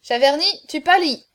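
high, loud taunt; loud heckling. A narrator's reading. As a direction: call it out at the drinker. Onlookers heckle the failing drinker.

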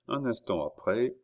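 ordinary delivery

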